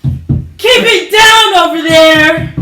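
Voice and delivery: full-voiced yell at the neighbors; shout. Real, not acted. Yelling through the wall at noisy neighbors, loud.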